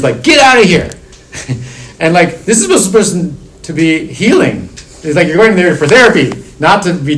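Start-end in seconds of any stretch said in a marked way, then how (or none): 0.2-0.9 sharp shouted command, pitched up; a mock startled yell; retold scream. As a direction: bark the therapist's order at the door. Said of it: "The therapist opens the door and screams".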